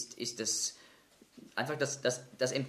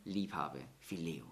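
softer, lower, fading sadly; 6 semitones lower, 7 dB quieter than usual. Retold or imitated. Petrus answers the Lord a third time, mildly sad. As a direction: let it sink, grieved. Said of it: "wird zuerst traurig".

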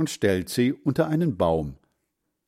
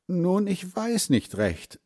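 higher doubtful woman's voice; 9 semitones higher than usual. A narrator's reading. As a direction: sound unsure, a little disapproving. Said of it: "sagt sie".

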